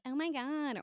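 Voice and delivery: put-on high-pitched valley girl; exaggerated valley girl exclamation; valley girl accent. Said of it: "valley girl quality".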